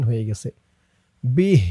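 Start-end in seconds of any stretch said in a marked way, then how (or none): 1.2-1.6 به closed on a breathed "h", no final vowel; sakin at the stop. Shown as a demonstration. Teacher shows the pause form, به ending on h. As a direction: stop به on an audible h. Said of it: "সাকিন".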